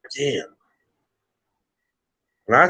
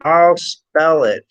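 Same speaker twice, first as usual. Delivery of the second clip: very slow, raised, emphatic repeat; pointedly slow; 4 semitones higher, 3 dB louder, much slower than usual. Real, not acted. Host offering spelling to a caller, pointedly slow. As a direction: draw each word out for someone slow.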